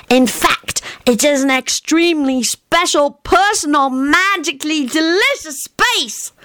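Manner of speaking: put-on high, earnest childish voice; a little boy explaining things very seriously; young Teddy. A narrator's reading. Narrator voicing Teddy explaining to grown-ups, high childish earnest.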